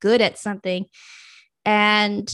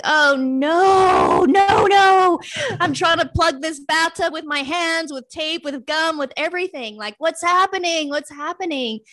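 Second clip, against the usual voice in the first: much higher, loud frantic "Oh, no, no, no"; panicked cry; 7 semitones higher, 4 dB louder than usual. Retold or imitated. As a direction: cry out as if the water is draining away. Passion draining, panicking bathtub image.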